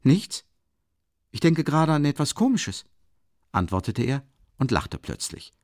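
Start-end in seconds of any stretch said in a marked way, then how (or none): 0.0-2.8 high, lively brush-off; agitated reply. A narrator's reading. Brother brushing off his sister's question, high agitated reply. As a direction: answer too brightly, pitched up.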